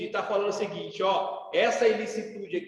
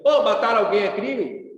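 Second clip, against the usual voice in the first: louder, pushy relative barging in with the question; a loud mock uncle demanding an answer; loud uncle asking; 8 dB louder than usual. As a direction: call it out like an annoying uncle at Sunday lunch. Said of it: "chega assim".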